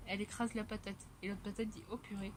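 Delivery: ordinary talk, nothing marked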